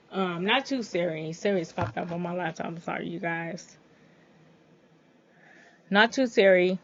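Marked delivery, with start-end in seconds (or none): 5.9-6.8 much louder, insistent correction. Real, not acted. Vlogger correcting Siri after it popped up, loudly. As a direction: say it loudly to shut down the assistant. Repeats correction to misfiring voice assistant.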